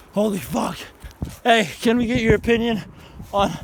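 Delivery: normal talking voice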